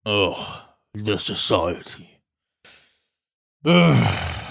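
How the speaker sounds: a grumbling, slow, bored royal voice; grumpy dwarf king. Acted. Dwarf king greeting the party, slow and grumbling. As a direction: drawl it out like a fat king annoyed by visitors.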